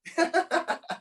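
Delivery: laughs. Real, not acted. Laughs at himself.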